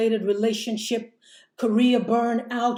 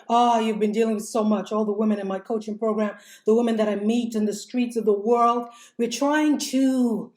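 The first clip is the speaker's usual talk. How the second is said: normal talking voice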